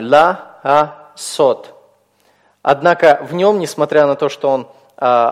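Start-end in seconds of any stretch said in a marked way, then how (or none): 0.0-1.7 «ла, а, сот» with a gap after each syllable; teacher's syllable-by-syllable «ла, а, сот»; split into syllables. Shown as a demonstration. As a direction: break «ла, а, сот» into separate syllables. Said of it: "этот слог у нас открытый и безударный".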